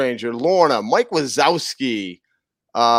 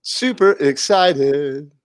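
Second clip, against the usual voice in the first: slow, stretched super excited; slow echo; much slower than usual. Pretend. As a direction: drag it out playfully. Echoing phrase slowly, mock voice.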